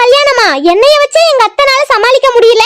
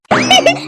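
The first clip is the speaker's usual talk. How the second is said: high cheeky giggle; 6 semitones higher, 3 dB quieter than usual. Acted. Daughter-in-law pleased she escaped cooking.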